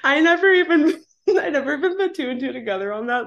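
fast, laughing through words. Real, not acted. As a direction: laugh as you speak. Laughing at her own story.

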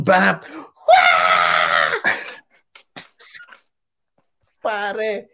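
loud burst then high cry; crowd roar. Retold or imitated. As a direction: recreate the screaming gym. Recreating crowd roar after song.

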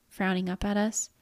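ordinary delivery, nothing marked

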